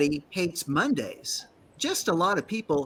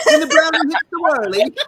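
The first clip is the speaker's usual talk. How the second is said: higher, louder mock English accent; hammed-up Brit, pitched up; 9 semitones higher, 8 dB louder than usual. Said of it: "is how the English say it".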